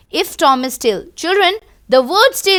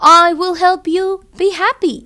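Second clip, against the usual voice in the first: dog Ben's cheerful line; 6 semitones higher, 5 dB louder, much slower than usual. Acted. Reading Ben's line to class.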